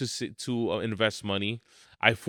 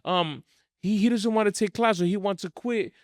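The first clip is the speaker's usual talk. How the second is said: mocking a fretting parent; 7 semitones higher than usual. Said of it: "these parents are like".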